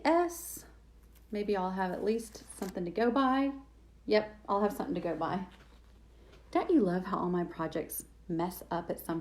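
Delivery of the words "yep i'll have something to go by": usual speaking voice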